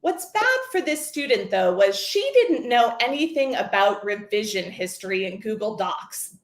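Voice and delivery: usual speaking voice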